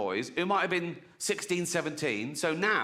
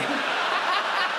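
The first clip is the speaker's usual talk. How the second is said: laughing at own punchline; high burst of laughter; 4 dB louder than usual. Real, not acted. Comic cracks up at his own dwarves joke. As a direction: break up laughing.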